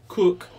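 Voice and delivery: "Cook" as a loud, high duck quack missing its ah; short honked "Cook" with a clipped "oo"; "Cook" blurted loudly like a broken quack. Acted. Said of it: "explained Quentin".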